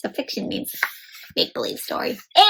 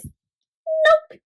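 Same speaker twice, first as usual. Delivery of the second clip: whiny narrator. Acted. Narrator voiced saying nope.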